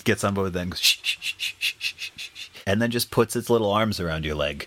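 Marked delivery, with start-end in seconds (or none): 0.8-2.5 string of shushes, fading away; repeated shushing. Acted. Child joins in the shushing, fading shushes. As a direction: shush over and over like a copying kid. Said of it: "The, the kid that you shush".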